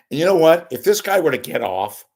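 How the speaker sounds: usual speaking voice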